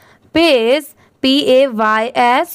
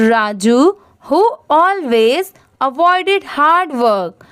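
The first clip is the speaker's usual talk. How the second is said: very slow, clear phrase-by-phrase reading; teacher modelling the paragraph for learners; slow model reading; much slower than usual. Shown as a demonstration. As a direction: show the class how to read it, slowly. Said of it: "मैं आपको पढ़ के दिखाती हूँ कैसे पढ़ना है".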